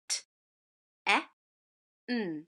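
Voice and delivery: T, e, n as three isolated phonics sounds; sounding out. Shown as a demonstration. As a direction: say T, e, n as short sounds t, eh, nnn with gaps. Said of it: "Read those three letters".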